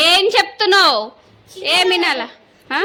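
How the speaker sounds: high, loud exasperated shout; angry yell. Real, not acted. Mother scolding kids.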